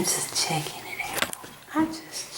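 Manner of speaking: unvoiced, hushed reply; hushed whisper, slowing down; whispered aside. Real, not acted. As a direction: whisper it while checking the gear. Hushed aside while checking camera.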